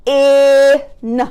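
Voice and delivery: "P-in" split, vowel held long; chopped word. Shown as a demonstration. Teacher chopping a word for pupils, sounds split apart. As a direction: chop PIN sound by sound. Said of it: "let's chop".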